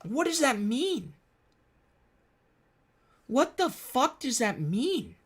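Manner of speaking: high, slow outraged disbelief; exasperated disbelief. Real, not acted. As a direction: drag it out high in utter disbelief. Exasperated at the claim.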